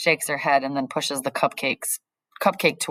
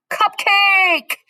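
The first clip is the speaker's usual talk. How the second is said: much higher, louder cry of delight; excited shout; 6 dB louder than usual. A narrator's reading. Max spots the cupcake, excited shout. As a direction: yell the word like a treasure.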